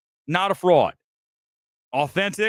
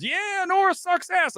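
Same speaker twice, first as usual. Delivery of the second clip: much higher, slow mock crowd voice; mocking latecomers; slower than usual. Mocking the critics who pile on late, in a much higher slow mock voice. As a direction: sneer it like a copycat fan piping up late. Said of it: "everyone behind me storms in and goes".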